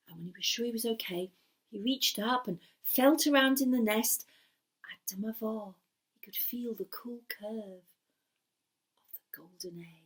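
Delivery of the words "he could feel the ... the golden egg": hushed, slow and wondering; slow whisper. A narrator's reading. Storyteller reveals the golden egg in a slow whisper.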